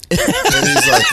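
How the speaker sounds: high-pitched burst of laughter. Real, not acted. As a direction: crack up high. Laugh mid-story.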